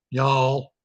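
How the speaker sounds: a single Southern y'all, said as one drawn syllable. Shown as a demonstration. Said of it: "a lot of different accents out there".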